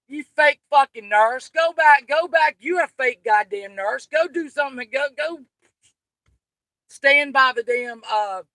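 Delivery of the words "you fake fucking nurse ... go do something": loud, higher angry yelling; furious shout. Real, not acted. Yelling at the fake nurse in chat, loud and furious.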